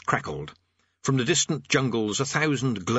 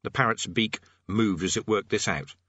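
plain reading voice

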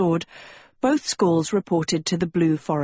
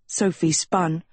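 ordinary delivery, nothing marked

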